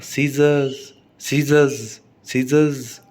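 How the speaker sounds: "सीजर्स" as see-zuhz, with no R; silent R. Shown as a demonstration. Teacher demonstrating scissors to students, "सीजर्स" with silent C and R. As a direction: repeat "सीजर्स" word by word, dropping the R. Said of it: "आर नहीं बोला".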